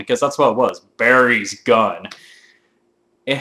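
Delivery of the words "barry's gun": drawn-out, savoured naming; slow reverent naming. Pretend. Gamer savouring Barry's gun, slow and reverent. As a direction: say it slowly, like a sacred weapon.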